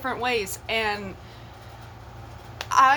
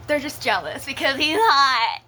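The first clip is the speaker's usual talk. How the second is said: put-on defiant young self; 3 semitones higher, 5 dB louder than usual. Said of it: "I was just like".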